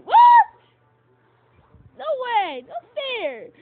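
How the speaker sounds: high, loud indignant yell; outraged shout. Real, not acted. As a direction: shout it's unfair. Opponent's surprise turn.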